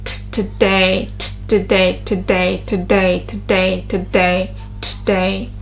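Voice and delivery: TODAY with aspirated T and weight on DAY; second-syllable stress. Shown as a demonstration. Teacher drilling where stress falls, TODAY stressed on DAY. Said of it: "is on the second syllable".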